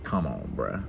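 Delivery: quiet, low disbelief at her; exasperated come on. Retold or imitated. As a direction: say it low to yourself, fed up. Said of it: "And it's like".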